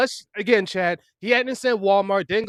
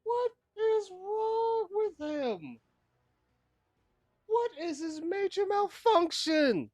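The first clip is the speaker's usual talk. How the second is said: exasperated disbelief; 11 semitones higher, 4 dB quieter, much slower than usual. Real, not acted. Streamer disgusted by the story.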